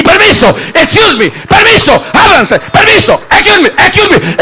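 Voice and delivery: high calls of excuse me, growing to a shout; pushing through a crowd. Acted. Sick woman forcing her way to Jesus, high urgent shouting. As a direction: elbow through the crowd, calling out.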